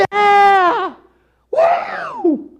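high, loud whoop from the stands; wild cheering parent. Acted. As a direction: cheer like your kid is playing. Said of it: "acting like a fool in the stands".

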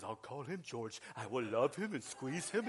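low, soft dopey monster; abominable snowman voice. Retold or imitated. Preacher imitating the cartoon snowman to the church, low and soft. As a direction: rumble it low like a gentle cartoon brute. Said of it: "he says".

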